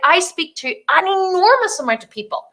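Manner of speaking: usual speaking voice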